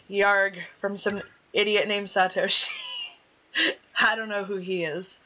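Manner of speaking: ordinary talk, nothing marked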